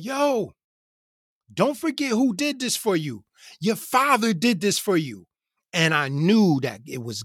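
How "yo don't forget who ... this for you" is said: imitating a man hollering his message; retold yell. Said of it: "he, he yells out".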